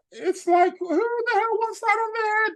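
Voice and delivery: squeaky high voice, exasperated: who would want that; high mock disbelief. Mock reaction to a leader's burdens.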